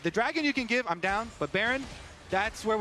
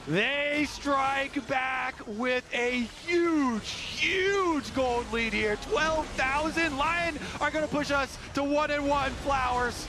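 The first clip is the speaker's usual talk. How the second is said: big drawn-out victory call; triumphant esports caster cry; live play-by-play; 5 semitones higher, slower than usual. Real, not acted. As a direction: stretch the words out, slow and high.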